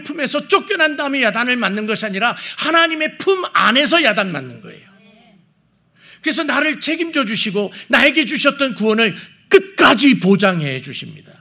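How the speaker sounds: high, forceful sermon delivery; impassioned preaching. Real, not acted. Preacher telling the congregation of God's grace, impassioned preaching. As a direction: preach it with swelling conviction, dropping at sentence ends.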